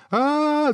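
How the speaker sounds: very high sustained scream; startled scream. A narrator's reading. Hero seeing the dragon's head, high startled scream. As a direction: scream out in fright. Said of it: "закричал герой".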